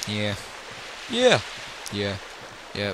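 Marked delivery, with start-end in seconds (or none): none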